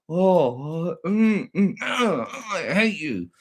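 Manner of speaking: high hammed-up groans sliding into slow hate; mock hater moaning. Streamer mimicking haters flicking through videos, high moaning mockery.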